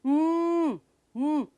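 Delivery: long "ਹਮ" as a closed-lip mmm; sustained "ਹਮ" hum; M sound. Shown as a demonstration. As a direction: hold "ਹਮ" with no vowel. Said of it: "ਆਪਣੇ lips ਨੂੰ".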